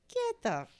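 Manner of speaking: light imitation of a gruff Joe Pesci brush-off; Joe Pesci impression. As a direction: toss it off like a tough guy, half laughing. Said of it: "Just like that, in that voice".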